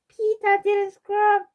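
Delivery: put-on high, loud, slow little-kid tattle; tattling toddler. A narrator's reading. Fudge tattling on Peter at the table, high child voice. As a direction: tell on him in a high child voice.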